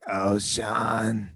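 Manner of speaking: raspy [name] voice; slow, raspy seductive drawl; smoker's rasp. Pretend. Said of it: "that's how you think [name] sounds like a chain smoker".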